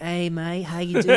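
high, cheery mock Australian greeting; Australian accent. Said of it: "she was Australian".

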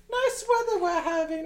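high-pitched polite small talk, hammed up; mock-genteel mom. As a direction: put on a falsetto society lady. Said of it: "then she meets it. It's like".

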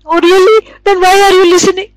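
high, quick, doubting retort; skeptical challenge. Retold or imitated. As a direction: call out the excuse. Teacher challenges the excuse.

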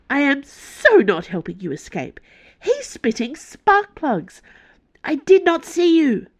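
high, indignant, hurried protest; a scandalized young woman refusing; agitated assistant voice. Acted.

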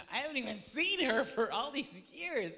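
high, loud disbelief; dumbfounded. Retold or imitated. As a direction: protest in baffled astonishment. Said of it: "I was, like, dumbfounded".

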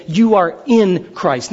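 "You are in Christ" slow, with weight on "in"; slow emphasis. Shown as a demonstration. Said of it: "Paul ... say".

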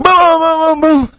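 high excited punch sounds; boxing blows. Retold or imitated. Preacher acting the final round, high excited booms. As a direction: punch out each boom up high.

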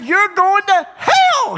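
high, loud blunt declaration; shouted hell warning. Retold or imitated. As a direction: blurt it out at the door. Repeating warning.

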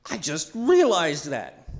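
slow, loud, drawn-out wonder; amazed realization. Shown as a demonstration. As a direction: let the discovery land slowly.

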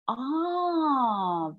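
high, drawn-out "ah" of realization; dawning realization. Retold or imitated. Speaker retelling how it clicked, dawning realization. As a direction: act out the penny dropping.

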